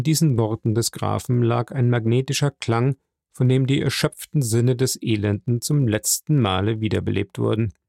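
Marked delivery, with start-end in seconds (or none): none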